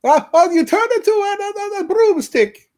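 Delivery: very high, hammy Italian mob voice; a goofy attempt at an Italian gangster; Italian mobster accent. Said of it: "I could do, like, an Italian mob".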